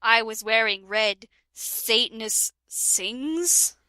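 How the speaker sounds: usual speaking voice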